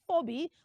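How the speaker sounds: Phoebe as "foh-bee"; O sound in the first syllable of Phoebe; wrong pronunciation. Shown as a demonstration. Teacher recaps a mistake, Phoebe as "foh-bee". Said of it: "So we don't say".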